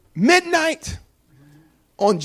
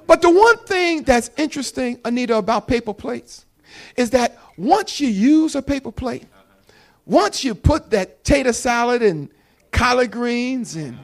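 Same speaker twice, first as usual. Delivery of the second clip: normal talking voice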